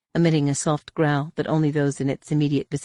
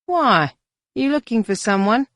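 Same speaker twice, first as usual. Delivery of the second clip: suspicious; 8 semitones higher, 5 dB louder than usual. A narrator's reading. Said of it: "looked at him suspiciously".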